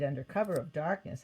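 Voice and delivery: usual speaking voice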